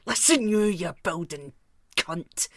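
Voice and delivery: scare him out of the shop; half-hearted pretend tough-guy threat; imagined threat. Imagined threat to rude customer.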